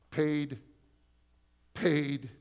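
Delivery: loud ringing repeated proclamation; proclaimed loudly. Acted. God declaring the debt paid over His church, loudly proclaimed. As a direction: shout it out like a verdict.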